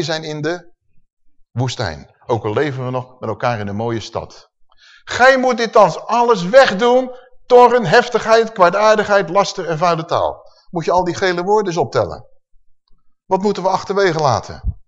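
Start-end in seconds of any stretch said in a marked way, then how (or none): 5.0-10.4 forceful scripture reading. Acted. Preacher reading the verse to his congregation, loud and forceful. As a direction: thunder the list of sins at the congregation.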